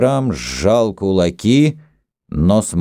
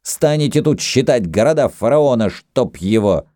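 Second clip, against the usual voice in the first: raised-pitch irritated outburst; angry bark; 6 semitones higher than usual. A narrator's reading. Rapsun fed up with counting pharaoh's cities, mildly barked scolding. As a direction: scold them, pitch lifted. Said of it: "рявкнул в свою очередь Рапсун".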